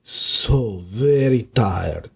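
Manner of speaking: put-on low, whispery, slowing hypnotic Spanish-accented purr; sleepy low Banderas whisper sliding into Count Dracula; Antonio Banderas impression drifting into Dracula. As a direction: lull them to sleep like a seductive vampire. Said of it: "Antonio Banderas talking like this".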